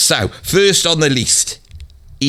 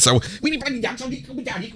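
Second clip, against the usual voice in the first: rapid-fire, higher full-on Brummy gabble; 5 semitones higher, 4 dB quieter, faster than usual. Shown as a demonstration. Showing off his proper voice, full-on fast Brummy. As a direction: rattle it off in thick Brummy, pitched up. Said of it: "so I went into full-on Brummy".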